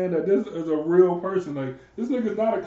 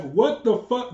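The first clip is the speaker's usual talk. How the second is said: ordinary delivery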